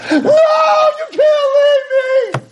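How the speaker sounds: top-of-the-lungs drawn-out scream; high, slow, wailing shriek. Retold or imitated. Daughter pulled off her mother, screaming at full lung. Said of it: "she's screaming at the top".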